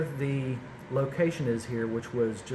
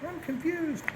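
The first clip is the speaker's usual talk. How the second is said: much higher, slow, puzzled voice; confused viewer voice; 4 dB quieter than usual. Retold or imitated. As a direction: squeak it out like a baffled viewer. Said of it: "I have people saying".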